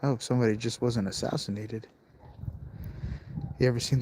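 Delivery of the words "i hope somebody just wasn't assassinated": very soft, low and wary; hushed alarm. Real, not acted. Vlogger to viewers after hearing gunshots, hushed and wary. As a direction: keep your voice right down, nearly whispering at first. Hears gunshots outside at night.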